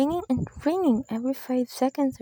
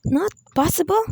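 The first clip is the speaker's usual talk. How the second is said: indignant disbelief; 5 semitones higher, 3 dB louder than usual. Acted. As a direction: pitch jumps up on possible, outraged. Outraged at operator's refusal.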